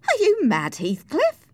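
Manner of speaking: high, loud, incredulous question; shocked, high-pitched outburst; appalled disbelief. Acted. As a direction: challenge him in alarm. Alarmed servant answers a threat.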